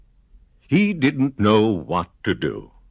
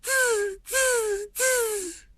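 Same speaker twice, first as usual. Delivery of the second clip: very high squeaky tz tz tz; monkey chatter; 5 dB quieter, much slower than usual. Acted. Monkeys mocking the peddler, high squeaky chatter. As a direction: chatter back like cheeky monkeys.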